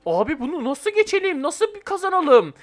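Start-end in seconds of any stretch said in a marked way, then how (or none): none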